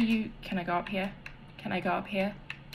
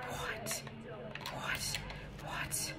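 whispered disbelief; real whispered shock; stunned whisper; 3 semitones lower, 17 dB quieter, slower than usual. Real, not acted. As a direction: mouth it in shock. Hiding, stunned.

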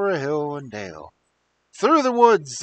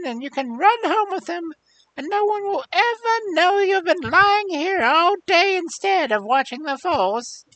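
put-on old hag voice; 5 dB louder than usual. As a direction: croon it like an old witch.

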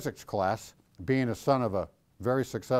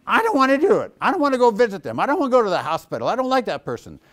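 high, loud whining refusal, rushing at the end; stubborn donkey whine; 9 semitones higher, 8 dB louder, faster than usual. Retold or imitated. Pastor voicing himself as Balaam's ass, high whiny refusal. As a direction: dig in your heels like a balking donkey. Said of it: "sitting on his haunches going".

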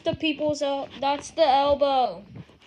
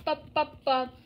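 ordinary talk, nothing marked

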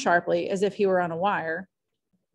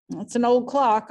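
idiotically; 4 semitones higher, 5 dB louder than usual. A narrator's reading. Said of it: "I told them idiotically".

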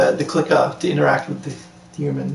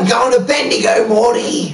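raised, hammed-up Australian accent; mock Australian accent; 7 semitones higher, 4 dB louder than usual. Joking with a friend about Bendigo, hammed-up Australian accent. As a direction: push a fake Australian accent up high. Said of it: "can't even do an Australian accent".

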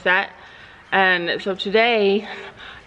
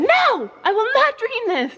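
mock protest. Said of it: "I said".

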